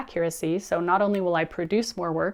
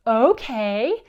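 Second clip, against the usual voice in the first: loud, higher, drawn-out happy agreement; big-smile okay; 4 semitones higher, 6 dB louder than usual. Retold or imitated. Son agreeing to clean up Legos, loud gleeful okay. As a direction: imitate a kid proudly agreeing with a grin. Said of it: "smiles, big smile, and says".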